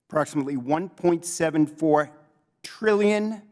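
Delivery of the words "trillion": trillion lifted higher and held for weight; stress on trillion. Real, not acted. Investigator stressing the sum to the committee, trillion punched high. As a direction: hit trillion to show the size of the sum. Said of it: "with a T".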